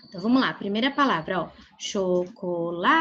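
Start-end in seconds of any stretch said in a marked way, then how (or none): 1.8-3.0 Chocolate very slowly, LA held long, then a separate TE; stress on LA in Chocolate. Shown as a demonstration. Teacher showing the strongest syllable, Chocolate with LA drawn out. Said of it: "qual que é a sílaba mais forte".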